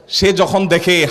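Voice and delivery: usual speaking voice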